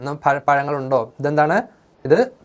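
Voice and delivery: ordinary delivery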